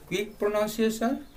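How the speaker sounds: ordinary talk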